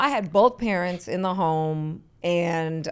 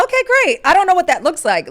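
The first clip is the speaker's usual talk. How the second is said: high, loud mock enthusiasm; sarcastic "okay, great"; mock cheerful; 10 semitones higher, 9 dB louder than usual. Mock cheerful reply to youngsters.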